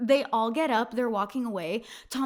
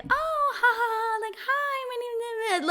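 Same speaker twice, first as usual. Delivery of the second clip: much higher, a silly giggling hello; mock giggly girl; 12 semitones higher than usual. Mocking how a fake-friendly woman would chirp hello. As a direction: parody a bubbly girly greeting.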